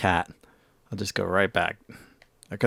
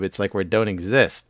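ordinary talk, nothing marked

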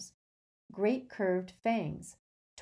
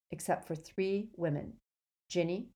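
plain reading voice

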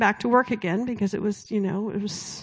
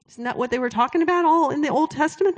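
usual speaking voice

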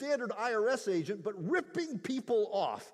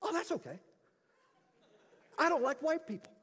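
lady's cheerful reply; 8 semitones higher, faster than usual. Retold or imitated. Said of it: "and she says".

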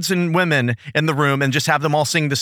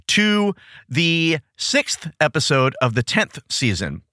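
ordinary talk, nothing marked

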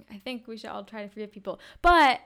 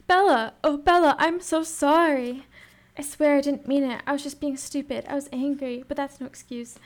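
louder, higher, gushing plea; over-the-top apology; 4 semitones higher, 10 dB louder than usual. Acted. Reading out Jacob's apology to Bella, over the top. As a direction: overdo the apology, starting big and easing off. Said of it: "just a bit over the top".

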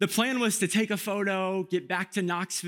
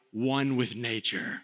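mock caption voice; 6 semitones lower, slower than usual. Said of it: "with a caption like".

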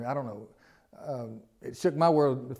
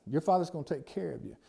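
ordinary talk, nothing marked

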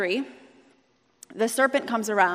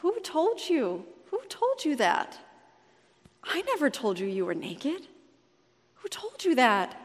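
heartbroken asking; 4 semitones higher, 5 dB quieter than usual. Retold or imitated. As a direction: ask it like a wounded parent. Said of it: "feel the sadness in God's voice".

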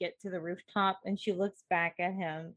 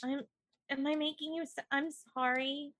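mildly tearful, higher-pitched apology; 6 semitones higher than usual. Acted. Crying girl worries she has saddened her friend, mildly tearful. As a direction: apologize as if a little weepy. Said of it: "she's crying".

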